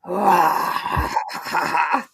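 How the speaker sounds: drawn-out squawk, rising; imitated hen squawk. Retelling Gladys the hen squawking, drawn-out mock squawk. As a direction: mimic a squawking hen in one long cry. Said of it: "Gladys is squawking. She".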